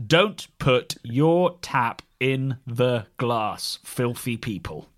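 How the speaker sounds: very slow, every word its own sentence; full stop between each word. Retold or imitated. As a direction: read it out word by word, deadpan. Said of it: "With full stops between each word".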